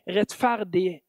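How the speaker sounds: ordinary delivery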